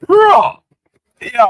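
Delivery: a very high, loud "Bruh!"; outraged disbelief. Real, not acted. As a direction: blurt it out in shock, way up high. Reacting to police news.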